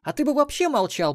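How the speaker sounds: high, loud female retort; snapping round. A narrator's reading. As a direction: fire back instantly, high and loud. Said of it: "моментально развернулась Мелисса". (резкий громкий выпад высоким женским голосом)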